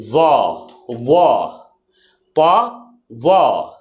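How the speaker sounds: ظا with tongue out, thick and heavy; heavy zaa. Shown as a demonstration. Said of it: "ایسے زبان کو باہر لا کر کے وا".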